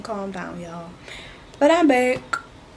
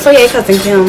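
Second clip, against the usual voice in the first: mock rant. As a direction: rant at Kim like a wronged fan. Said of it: "my little rant about Skims".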